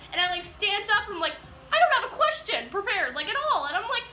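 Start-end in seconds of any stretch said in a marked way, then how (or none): 1.7-3.7 higher, louder retold panic; freaking out. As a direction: re-enact a flustered fan put on the spot. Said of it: "freaking out and shaking".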